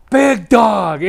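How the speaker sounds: high, loud mock call of the nickname; retold shout. Big guy in the doorway greeting the fan, a loud shouted call. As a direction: bellow the nickname across the room.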